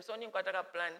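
lightly voiced conspiring Lucifer; a hint of a sly plotter, in Nyanja; mild scheming Lucifer voice. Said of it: "Lucifer ... told them".

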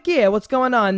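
put-on loud New York accent, tough-guy attitude; mock New York tough guy, loud; exaggerated New Yorker. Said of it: "just say it like a New Yorker".

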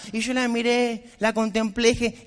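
usual speaking voice

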